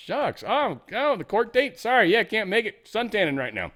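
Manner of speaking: high-pitched fake dismay; mock apology. Accused founder ducking the court date, mock-apologetic.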